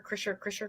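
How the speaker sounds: "Kreischer" softly as KRISH-er, twice; trying a pronunciation. Shown as a demonstration. Host unsure of the mansion's name, quietly testing pronunciations. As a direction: test "Kreischer" under the breath with a short i. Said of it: "I don't know how to say it".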